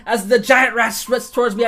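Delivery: usual speaking voice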